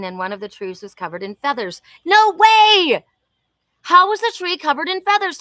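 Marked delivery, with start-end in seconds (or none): 2.0-5.4 high, loud burst of disbelief; delighted no way; surprised. Real, not acted. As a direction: gasp it out, thrilled and amazed. Hears about feathers on tree.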